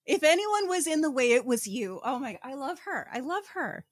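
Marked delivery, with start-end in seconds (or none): none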